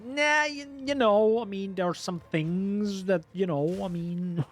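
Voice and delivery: high-pitched sheepish dodge, words drawn into a laugh; playful hedging. Acted. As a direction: hem and haw in a high, coy voice. Playfully dodging being told he needn't buy.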